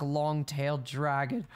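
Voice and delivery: usual speaking voice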